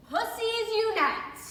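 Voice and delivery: loud, high protest slogan; mock rallying cry. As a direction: call it out like a rally chant.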